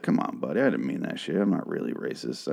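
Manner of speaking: low, soft wheedling; coaxing. Retold or imitated. Merle coaxing T-Dog for the hacksaw, low soft plea. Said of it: "Merle tries to talk T-Dog into".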